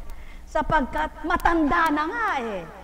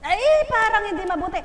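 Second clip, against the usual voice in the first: a higher, fast teasing sigh; playful teasing; 7 semitones higher, 4 dB louder, faster than usual. Real, not acted. Preacher teasing a congregant, playfully high and fast. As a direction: tease them for the weak answer. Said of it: "teasing the quiet answer".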